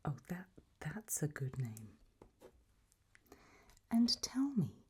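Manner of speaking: ordinary delivery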